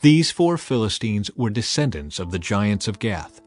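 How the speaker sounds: usual speaking voice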